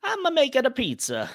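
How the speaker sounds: high, hammed-up Italian Mario voice; mock pizza-chef Mario. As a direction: ham up a cartoon Italian plumber. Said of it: "we need you to really be a stereotype to be Mario".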